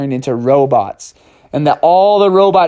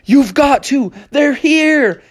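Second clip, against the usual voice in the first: urgent; 10 semitones higher, slower than usual. Acted. Bubby urging, parents at door.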